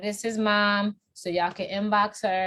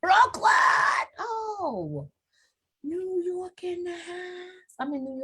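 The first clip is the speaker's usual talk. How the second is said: high hype shout; high whooping shout for Brooklyn; excited shout; 11 semitones higher, slower than usual. Real, not acted. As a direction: cheer his borough like a host. Hyping up his hometown.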